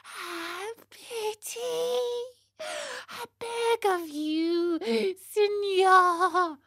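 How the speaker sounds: high, faint, drawn-out begging; tiny tearful puppet voice, slowly imploring; pitiful weeping plea. Acted. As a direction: plead tearfully, barely able to be heard at first. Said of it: "asked in a pitiful voice which could scarcely be".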